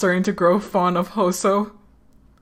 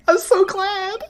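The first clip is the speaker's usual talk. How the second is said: laughing. Real, not acted. Laughing with delight.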